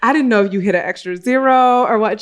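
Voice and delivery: mock sheepish explanation with a laugh; laughing sheepishly. Herself explaining she checked for an extra zero, laughing. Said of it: "And I was like".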